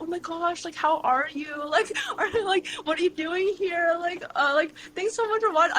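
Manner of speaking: high-pitched, gushing fangirl greeting; excited fan. Retold or imitated. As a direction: gush like you're meeting your idol. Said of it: "I get really excited".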